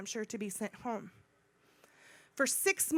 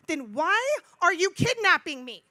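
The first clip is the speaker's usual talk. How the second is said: slow, raised, emphatic question; indignant challenge to captors; retold loud protest; 5 semitones higher, 7 dB louder than usual. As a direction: draw out the demand, loud and high. Retold protest at arresting officers.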